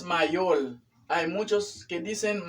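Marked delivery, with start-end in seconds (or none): 0.0-0.8 "mayor" said as "mayol", ending in L; "mayor" mispronounced as ma-YOL; learner's L for R. Retold or imitated. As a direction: imitate the mistake, "mayor" with final L. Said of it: "No es mayor".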